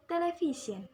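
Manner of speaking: "television" with VI emphasized, falling after; stress before final syllable. Shown as a demonstration. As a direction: lean on VI in "television". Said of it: "The stress is in the previous syllable".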